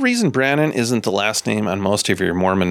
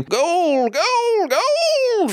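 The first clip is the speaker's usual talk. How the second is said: put-on cackly wheezy old timer, very high; grizzled prospector; much slower than usual. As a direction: shriek gold like an old prospector. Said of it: "my grizzled prospector voice".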